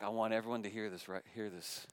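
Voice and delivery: ordinary delivery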